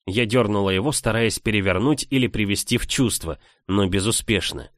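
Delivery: plain reading voice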